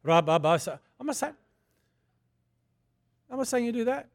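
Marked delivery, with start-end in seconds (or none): 0.0-0.5 mock tongues. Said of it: "walk around shopping centres and go".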